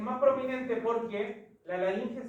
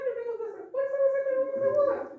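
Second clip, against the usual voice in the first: very high, squeezed demo voice, fast and louder; voz aguda; 6 dB louder, faster than usual. Shown as a demonstration. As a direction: force the voice right up high, rushing it. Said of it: "intenta hacer la voz más aguda". (voz muy aguda, forzada y rápida)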